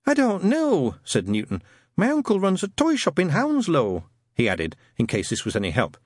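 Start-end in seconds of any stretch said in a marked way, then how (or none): 0.1-4.0 high, polite, timid lad; nervous young man. Acted. Newton asking Shadwell about the ad, timid and high. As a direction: ask shyly, voice up.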